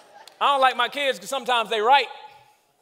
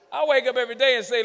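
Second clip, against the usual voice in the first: ordinary talk, nothing marked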